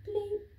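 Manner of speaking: high electronic bleep; quick high mock keypad beep; beep noise. Keypad beep voiced in story.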